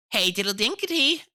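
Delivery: high, loud sing-song mouse voice. A narrator's reading. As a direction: chant it brightly like tiny mice. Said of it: "answered the little mice".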